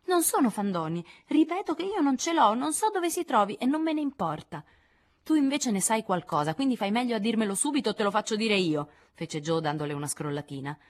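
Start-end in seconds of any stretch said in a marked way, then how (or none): 0.1-4.6 molto più acuta, ostinata; voce di bambina stizzita. Acted. Amy ribatte nel litigio. 5.2-8.9 più alta e dura; minaccia. Acted. Jo minaccia Amy.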